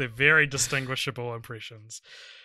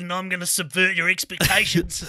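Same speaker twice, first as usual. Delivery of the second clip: higher put-on mocking voice; Richard; 3 semitones higher, 3 dB louder than usual. Host imitating his podcast partner, mocking impression. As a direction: take the piss out of a co-host. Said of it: "have impressions of each other".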